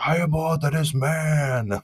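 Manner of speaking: high, loud, slow hammed-up declaration; mock macho proclamation. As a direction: proclaim yourself king of men, tongue in cheek.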